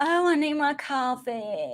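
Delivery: put-on slow, drawn-out accented coffee plea; hammed-up drawled accent on coffee; mock coffee accent. Host joking about needing coffee, slow mock accent.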